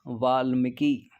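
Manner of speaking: Valmiki drawn out slowly syllable by syllable; slow spelling out. Shown as a demonstration. Teacher to students, slowly spelling out the word. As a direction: stretch Valmiki slowly as if writing it. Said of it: "Valmiki बताइए कैसे लिखेंगे?".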